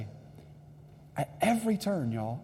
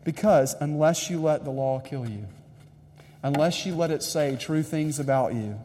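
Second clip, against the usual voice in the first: ordinary delivery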